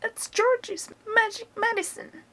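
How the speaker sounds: put-on high, cackly old grandma calling down; high-pitched old woman showing off; Grandma's voice. A narrator's reading. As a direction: crow it from the rooftop as Grandma. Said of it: "Grandma shouted".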